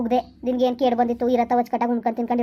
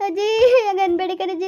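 acted plaintive appeal; pleading; 7 semitones higher than usual. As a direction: beg her, voice lifted high. Pleading with grandmother in drama.

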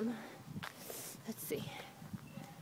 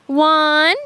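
loud coaxing; 4 semitones higher, 13 dB louder, much slower than usual. Shown as a demonstration. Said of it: "counting with toddler".

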